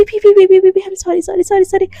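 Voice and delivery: high, loud, rushed stammering sorry; panicked apology. Acted. Radhika panicking as Devika starts to cry, frantic sorry. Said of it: "Devika थोड़ी सी emotional हो जाती है".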